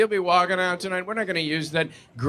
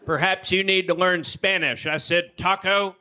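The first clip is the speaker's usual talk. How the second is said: Texas drawl; slower than usual. Retold or imitated. Angry customer yelling at Taco Bell staff, Texas drawl. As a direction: play the impatient Texan at Taco Bell. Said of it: "It went something like this".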